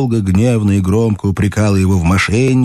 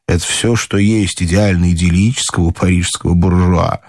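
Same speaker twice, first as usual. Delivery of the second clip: usual speaking voice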